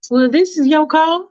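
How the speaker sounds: a fussy woman hedging politely; Faith's fussy voice. Acted. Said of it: "And she's like".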